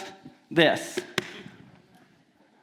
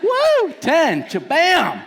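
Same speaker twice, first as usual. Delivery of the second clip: much higher, louder whoop, slow; hammed-up victory whoop; 10 semitones higher, 5 dB louder, much slower than usual. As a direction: shout it like a touchdown. Whoop at score.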